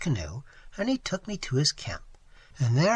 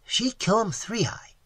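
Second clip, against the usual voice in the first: higher-pitched broken-English question; imitated Seminole man; 6 semitones higher than usual. Waitress imitates Tigertail asking about Three Eye, eager. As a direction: ask eagerly in the Seminole man's voice. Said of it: "even imitating his voice and gestures".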